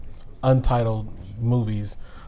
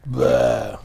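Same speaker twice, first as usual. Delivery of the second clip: zombie; 2 semitones higher, 6 dB louder than usual. Acted. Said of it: "your biggest zombie noise".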